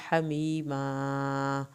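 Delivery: soft, very slow chant of حمیما; soft recitation. Shown as a demonstration. Teacher models a word, soft slow chant. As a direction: draw حمیما out softly. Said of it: "یہاں پہ ہا حلق سے پڑھیں گے".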